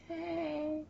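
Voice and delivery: very high, croaky "Hey"; croaky cam girl voice.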